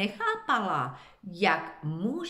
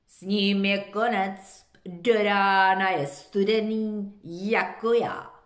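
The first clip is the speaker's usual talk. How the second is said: louder, odd-timbred frog; 7 dB louder than usual. A narrator's reading. Frog predicts Benny's end, louder. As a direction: croak it out flatly. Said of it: "nechala se slyšet žába".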